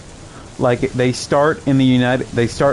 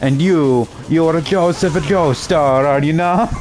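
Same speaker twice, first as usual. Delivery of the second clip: higher-pitched, hammed-up Italian accent; bad Italian accent; 4 semitones higher than usual. Fan acting out the dub's Caesar meeting Joseph, corny Italian accent. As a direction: do a poor Italian accent, proudly overdone. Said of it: "Just do it poorly".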